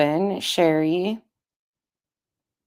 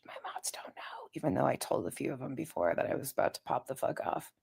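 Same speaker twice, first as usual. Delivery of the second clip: secretive whisper, much softer; 11 dB quieter than usual. Real, not acted. Streamer confessing to chat she snuck out, whispering. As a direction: confess it in a hush. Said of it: "we're whispering too".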